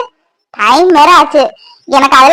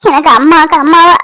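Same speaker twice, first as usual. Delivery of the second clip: ordinary talk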